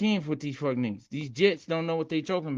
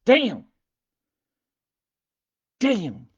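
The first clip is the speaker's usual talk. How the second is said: a sudden, louder, higher "Damn"; loud surprised damn; 7 semitones higher, 7 dB louder than usual. Real, not acted. Streamer spots a viewer joining, loud surprised exclamation. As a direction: blurt it out, caught off guard.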